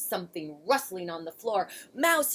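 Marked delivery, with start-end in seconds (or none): 1.9-2.3 loud high frightened call; crying out. A narrator's reading. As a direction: shout his name in fear. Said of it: "he cried".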